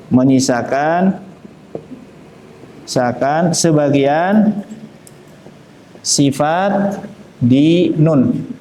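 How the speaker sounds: slow, word by word, with pauses; slow explanation. Shown as a demonstration. Teacher simplifying for students, slow and deliberate. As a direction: spell it out slowly for beginners. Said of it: "bahasa sederhananya gini".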